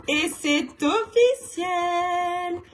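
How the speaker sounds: slow, high, sung mock celebration; ironic fanfare. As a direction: sing it out as a fake triumph. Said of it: "C'était ironique". (célébration ironique chantée, lente et aiguë)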